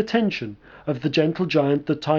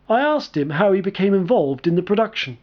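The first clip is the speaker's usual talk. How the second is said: ordinary talk, nothing marked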